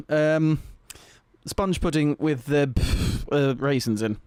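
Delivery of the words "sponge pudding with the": usual speaking voice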